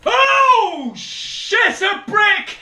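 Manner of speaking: very high, loud shriek; real screaming panic, urging escape; terrified scream. Real, not acted. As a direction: scream the curse, then yell to get away. Jump scare, fleeing in game.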